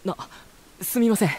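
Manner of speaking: sudden high, embarrassed sorry; flustered apology. Real, not acted. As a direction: blurt it out caught off guard. Flustered after mistaken address.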